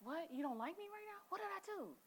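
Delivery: high, soft mock hurt; mock hurt partner. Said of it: "'cause you're like".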